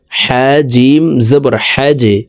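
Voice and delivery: slow spell-out, "হ্যাজে" ending in a bounced j; downward push on j. Shown as a demonstration. Teacher demonstrating qalqalah on jim, pushed j. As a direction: draw out the letters, then release the j of "হ্যাজে". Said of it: "নিচের দিকে ধাবিত করে ধাক্কা দিয়ে উচ্চারণ করব".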